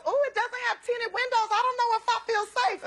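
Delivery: high, prissy-sounding retold friend; high mock Jada. Teller mimics Jada fretting, in a high voice.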